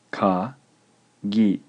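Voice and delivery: ka and gi said apart, with a pause; measured dictation in separate syllables. Shown as a demonstration. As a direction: dictate ka, then gi, each on its own. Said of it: "The word is two syllables".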